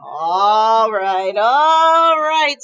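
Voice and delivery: hammed-up sigh of a dad; mock-weary papa. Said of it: "said Papa".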